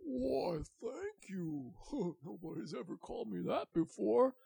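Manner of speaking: high, bashful thanks; flattered dragon. Acted. The dragon thanking Francesca, high and bashful. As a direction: blush through the thanks.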